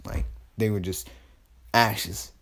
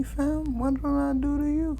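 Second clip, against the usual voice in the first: indignant disbelief. Retold or imitated. Naruto faces every village's army.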